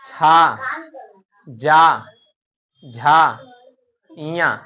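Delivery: छा जा झा said loud and apart; showing where the tongue touches; loud palatal demo. Shown as a demonstration. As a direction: hit each of छा, जा, झा hard. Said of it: "जब हम बोल रहे हैं चा".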